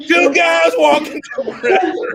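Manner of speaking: shouted opener. Acted. Comic starting the last version, loud call. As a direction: call it out big.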